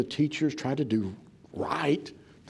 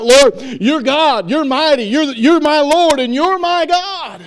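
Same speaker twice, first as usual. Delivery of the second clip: high, very loud cry building to a drawn-out peak; hollered worship; 13 semitones higher, 14 dB louder, slower than usual. Acted. Thomas falling on his face before the Lord, hollered. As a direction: holler it out slowly in awe, full voice. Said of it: "he just hollered out".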